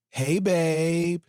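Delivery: bright casual greeting to husband; breezy call. A narrator's reading. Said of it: "she called out".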